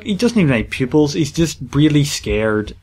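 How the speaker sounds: usual speaking voice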